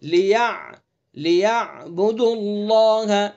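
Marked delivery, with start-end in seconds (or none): 1.1-3.3 slow, with the lam of "اللہ" said full and heavy; slow tajweed model of "اللہ" with heavy lam; heavy lam in Allah. Shown as a demonstration. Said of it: "اللہ کے لام کو آگے پر پڑھیں گے".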